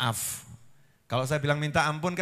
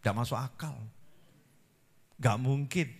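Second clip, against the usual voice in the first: soft disbelief; 5 semitones lower, 10 dB quieter than usual. Retold or imitated. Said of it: "Yang satu ngomong".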